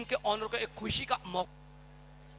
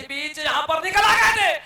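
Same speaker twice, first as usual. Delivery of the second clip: excited commentary; 5 semitones higher, 9 dB louder than usual. Real, not acted. Wicket falls in commentary.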